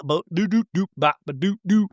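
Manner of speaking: vocalized slap-bass sitcom jingle; imitated theme riff. Mimicking the Seinfeld show music, imitated riff. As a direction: bounce through a mouth-made bass riff.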